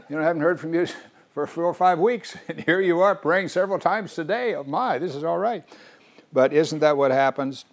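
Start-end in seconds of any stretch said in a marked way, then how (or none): 0.0-5.6 chuckling, gently teasing greeting; God amused to hear from a long-silent Phil. Pretend. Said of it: "God smiles and says".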